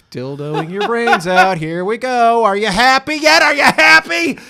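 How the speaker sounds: building high, loud mock fury; sarcastic outburst. Retelling his frustrated outburst at his girlfriend, loud. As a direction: shout it sarcastically, angrier each line. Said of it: "I was just like".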